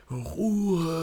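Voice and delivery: high, drawn-out mock yell; put-on high teacher call; teacher's order for silence. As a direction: imitate a teacher calling the class to order. Teacher slams ruler, orders silence.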